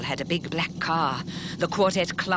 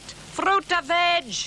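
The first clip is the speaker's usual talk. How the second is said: much higher, drawn-out cry; street hawker's call; 14 semitones higher, 4 dB louder, slower than usual. Acted. Leo hawking from his cart in the rain, a drawn-out higher call. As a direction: sing it out to the street. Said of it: "He called out".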